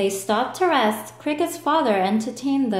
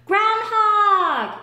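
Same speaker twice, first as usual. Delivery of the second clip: high, loud accusing yell; 12 semitones higher, 5 dB louder than usual. Acted. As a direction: blame Groundhog for the weather. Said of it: "yelled Alligator".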